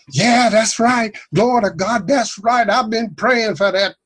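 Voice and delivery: high, thrilled answer from the crowd; excited woman. Retold or imitated. Retold woman in the crowd answering the preacher, excited. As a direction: answer like she can't believe her ears. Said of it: "she'd just get all excited".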